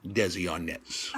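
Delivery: soft, slow, offhand mock Spanish; mock-casual broken Spanish to the cab driver; nonchalant. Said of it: "I say very nonchalantly".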